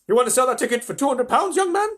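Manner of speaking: loud, high, pushy bidding; woman shouting. A narrator's reading. Woman outbids the man for the ticket, shouting. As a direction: outbid him at the top of your voice. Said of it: "shouted a woman".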